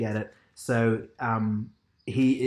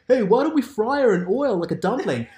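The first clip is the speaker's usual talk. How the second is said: higher, a bit louder heckling call; mock crowd yell; 9 semitones higher, 5 dB louder than usual. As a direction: call it out like a jeering bully in the crowd. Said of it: "some guy yells out from the crowd".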